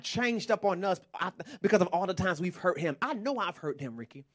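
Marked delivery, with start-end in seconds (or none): none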